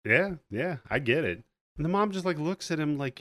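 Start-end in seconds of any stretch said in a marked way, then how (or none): none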